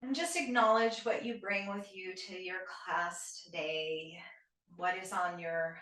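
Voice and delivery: soft, unhurried yoga nidra guidance; guided meditation. Shown as a demonstration. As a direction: ease listeners into stillness, with long pauses between phrases. Leads guided breathing meditation.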